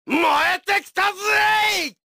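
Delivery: high, fast, rousing yell; fired-up shout. Acted. Fighter getting fired up for battle, excited shout.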